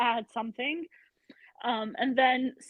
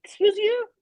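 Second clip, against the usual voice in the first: indignant retort; 9 semitones higher, 6 dB louder than usual. Retold or imitated. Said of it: "I'm like".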